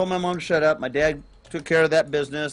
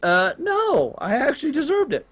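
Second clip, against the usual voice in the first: higher retold pleading with police; 10 semitones higher than usual. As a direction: beg them to understand. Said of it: "I was pleading with the police".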